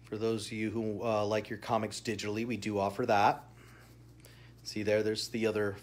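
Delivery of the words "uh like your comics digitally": usual speaking voice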